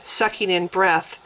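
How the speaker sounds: ordinary delivery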